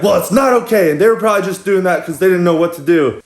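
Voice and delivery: high, indignant lecture; imitated scolding girl.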